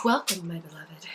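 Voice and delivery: low, soft sultry voice. Pretend. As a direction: purr it out seductively. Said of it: "now I just wanna read it".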